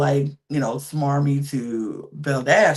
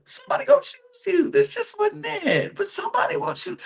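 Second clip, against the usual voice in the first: much higher, tender comforting to a friend; high reassuring gush. Retold or imitated. Vlogger comforting the rejected contestant in a high tender voice. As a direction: soothe her like a big sister. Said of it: "I was like".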